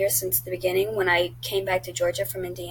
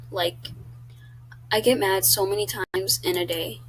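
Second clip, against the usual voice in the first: ordinary delivery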